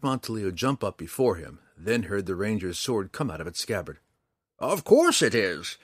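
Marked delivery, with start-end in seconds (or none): 4.6-5.8 put-on loud, slow, gleeful old ranger; drawn-out gloating cackle; cackling. A narrator's reading. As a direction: crow it out in character. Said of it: "Montaleo cackled".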